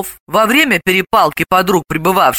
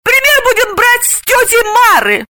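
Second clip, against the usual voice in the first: high, shouted declaration; triumphant high-pitched counter; emphatic exclamation; 4 dB louder than usual. Acted. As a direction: proclaim it in a high voice. Galina exclaims her counter-proposal.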